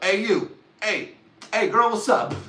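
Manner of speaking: loud, high mock holler at a girl; calling out to a girl. Teen boy hollering at a girl for a prom date. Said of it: "I was like".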